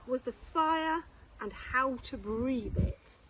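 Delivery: ordinary delivery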